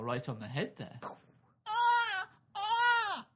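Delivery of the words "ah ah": put-on shrill wailing baby cry, very high. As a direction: wail like an angry newborn. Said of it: "an impression of a baby crying".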